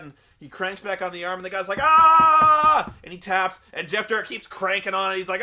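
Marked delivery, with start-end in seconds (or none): 1.8-2.9 loud high held "aah" of agony; long hammed-up yell of pain; mock pain scream. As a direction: imitate a wrestler howling in an armbar. Imitating man in painful armbar.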